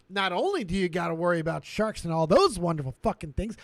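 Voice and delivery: ordinary talk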